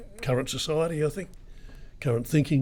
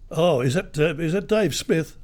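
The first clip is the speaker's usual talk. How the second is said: higher, bright fake mechanic on the phone; prank caller; 6 semitones higher, 4 dB louder than usual. Radio host posing as chief mechanic, calling the car owner in a higher voice. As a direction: put on a friendly fake mechanic voice.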